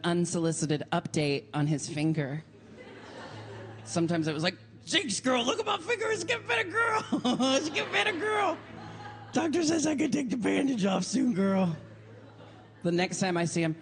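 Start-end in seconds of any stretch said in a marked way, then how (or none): 4.9-11.8 excited camp squeal. Retold or imitated. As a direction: squeal the good news. Friend gushing about finger update.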